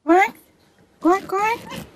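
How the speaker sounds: imitated animal call. Calling back to the fox.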